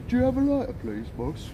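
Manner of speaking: normal talking voice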